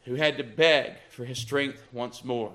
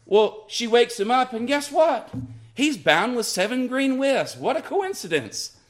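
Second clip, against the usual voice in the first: sarcastic; 7 semitones higher than usual. Real, not acted. Preacher mocks Samson's blindness.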